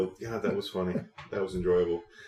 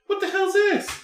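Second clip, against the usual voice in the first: squeaky, much higher "what the hell's this"; mock outrage; 5 dB louder than usual. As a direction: imitate the annoyed boys in a high voice. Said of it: "They were just mad".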